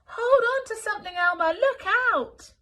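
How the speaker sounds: high, loud alarmed shout; shouted warning. Acted. Wilbur shouting a fake warning to Elmer. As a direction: yell over the storm. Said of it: "Wilbur called".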